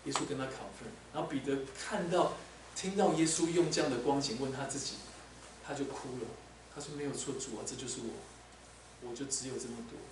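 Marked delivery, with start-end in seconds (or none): 5.6-10.0 a touch of tearful, hushed words; mild weeping. Acted. (彼得轻声哽咽地说)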